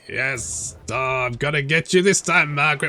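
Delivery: a hint of a gloating vampire lord; mild mock villain taunt at Margaret. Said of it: "it's vampire voice".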